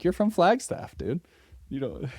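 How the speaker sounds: mock disbelief. Friend teasing the runner's new French accent in high mock disbelief. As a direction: poke fun at him, voice jumping way up. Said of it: "And it's like".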